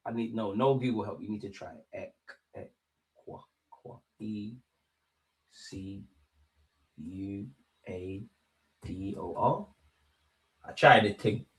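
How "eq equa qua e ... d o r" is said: hushed, halting letter by letter; sounding out a spelling. Real, not acted. Streamer trying to spell Ecuador without Google, sounding out quietly. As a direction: work out the spelling under your breath, haltingly.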